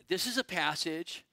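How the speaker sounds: ordinary talk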